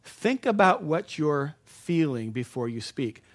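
slow, each word laid out; slow, deliberate step. Shown as a demonstration. Preacher giving the congregation step one, slowly. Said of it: "Step one".

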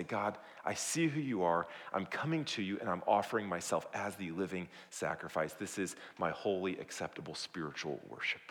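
soft, low surrender to God; humble prayer. Acted. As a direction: pray quietly and humbly, sinking at the end. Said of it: "but humbly say".

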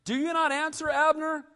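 high call across the hill. A narrator's reading. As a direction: call out to him from far off. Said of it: "David called out to the people".

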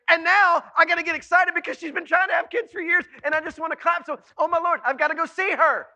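very loud, high, breathless-pressure rant; overwhelmed excitement. Acted. Preacher voicing Mary overwhelmed by her news, frantic excitement. Acts out Mary's overwhelmed inner speech.

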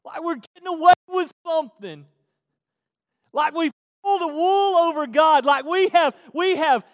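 high, very loud mock triumph; full-force, high-pitched pulpit mockery; mocking preaching shout. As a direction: preach it as a hammed-up boast. Mocks foolish glee at full force.

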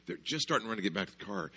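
ordinary talk, nothing marked

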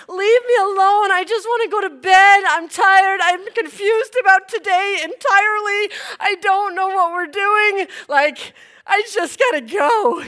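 high, loud, whiny protest; worn out and begging to escape; hammed-up overtired outburst; exasperated plea. Retold plea to be left alone.

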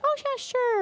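high-pitched, casual mock king; offhand royal yes, played for comedy; breezy agreement. King casually agrees to Haman's plan.

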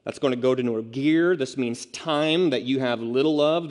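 ordinary talk

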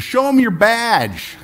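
high, loud and slowly drawn out; deadpan rancher. Acted. Rancher calls to the agent fleeing the bull, high, loud and slow. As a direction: stretch the punchline way up and out loud.

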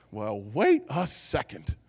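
slow, drawn-out wary reaction; slow mock suspicion; acted double take. Acted reaction to a strange sight.

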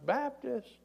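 high-pitched mock call from above; thin acted call back; yelling down. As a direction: imitate a distant holler without much volume. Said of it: "He yells down".

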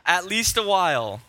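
high, slow, loud rallying speech; hammed-up battlefield oratory, easing down at the end; William Wallace. Preacher quoting Braveheart to the room, stirring mock-heroic call. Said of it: "The epic line of William Wallace saying".

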